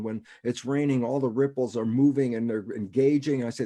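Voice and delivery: usual speaking voice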